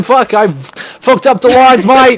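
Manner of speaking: loud, high mock Aussie-British accent. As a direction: curse the slip in a put-on accent. Slips into accent after botched line.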